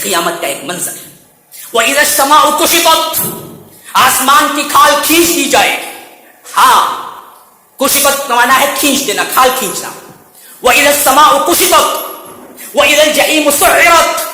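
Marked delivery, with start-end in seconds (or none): 1.7-3.2 slow, drawn-out chanted verse; slow recitation. Acted. Preacher intoning the verse slowly for the listeners, chanted. 10.6-14.3 slow, drawn-out chanted verse; slow recitation. Acted. Preacher intoning the verse slowly for the listeners, chanted.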